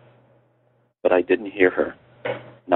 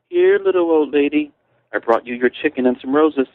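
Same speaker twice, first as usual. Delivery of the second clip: high, whiny mocking child voice; whiny bratty child; 7 semitones higher than usual. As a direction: whine it like a spoilt brat teasing. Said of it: "He began using a whiny voice, mimicking a bratty child".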